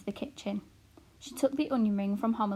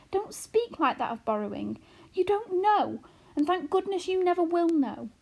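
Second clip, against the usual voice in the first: fearful mother; 9 semitones higher, 4 dB louder than usual. A narrator's reading. Homily warns.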